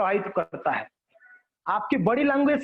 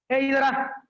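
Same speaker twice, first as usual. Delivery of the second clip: higher-pitched curt order to come; harsh, rude summons; 4 semitones higher, much slower than usual. Shown as a demonstration. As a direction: order them over rudely. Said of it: "कितना कर्कश लगती है". (कर्कश, रूखे स्वर में)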